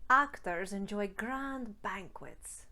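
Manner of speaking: slow Scottish "grand banquets" with an open ah; a slow Scottish drill sentence; Scottish accent. Shown as a demonstration. Coach drills a sentence in Scottish. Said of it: "Let's go through a few more".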